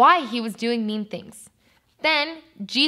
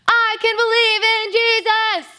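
high, loud rallying chant; louder; 10 semitones higher, 5 dB louder than usual. Shown as a demonstration. Teacher rousing children to answer who can believe, louder. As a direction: shout it out, high and drawn.

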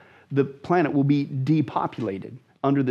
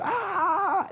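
short high mock scream; a quick pitched-up mock cry of pain. Victims screaming as butchered.

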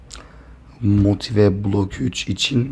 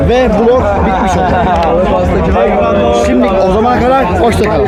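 loud, high, strained vlog voice; shouting over the crowd; 11 semitones higher, 6 dB louder than usual. Real, not acted. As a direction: shout it over concert noise. Konser sonrası gürültüde vlog kapanışı. (kalabalığın üstünden bağırarak)

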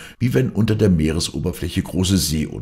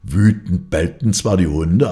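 plain reading voice

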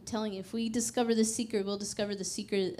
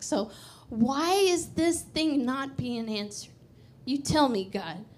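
slow, higher-pitched challenge to God; confrontational; 3 semitones higher, 3 dB louder, slower than usual. Retold or imitated. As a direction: demand an explanation, respectfully but pressing. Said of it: "I got confrontational".

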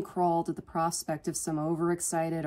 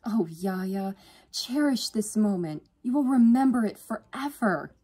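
gushing, higher and louder toward the end; swooning; 6 semitones higher, 6 dB louder than usual. A narrator's reading. Sister urging her to cherish move-in day, swooning. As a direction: swell into a dreamy, emphatic gush. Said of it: "Yvonne swooned".

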